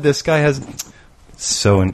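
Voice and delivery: ordinary talk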